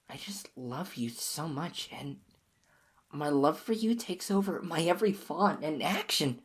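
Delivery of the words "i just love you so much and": softer and slow, almost melting; hushed, tender admission. Acted. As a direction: let the words sink in softly. Tender confession.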